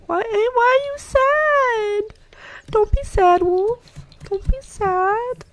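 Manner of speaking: high, slow cooing comfort. Real, not acted. Player comforts her sad dog Wolf, high cooing.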